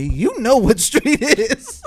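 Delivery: high, loud, slow imitation; mock the coach's accusing comeback, drawn out; indignant speech coach. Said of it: "she was like".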